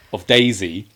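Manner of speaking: ordinary delivery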